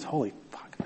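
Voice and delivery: breathless whispered swear under the breath; whispered curse. Real, not acted. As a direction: whisper it in shock. Realizes only ten minutes left.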